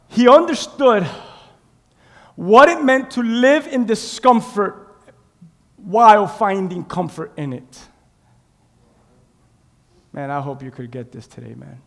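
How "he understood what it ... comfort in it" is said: loud, slow, spaced-out declaration; emphatic preaching. Acted. Preacher driving home Paul's comfort in discomfort, emphatic preaching. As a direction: proclaim it slowly, falling at the end.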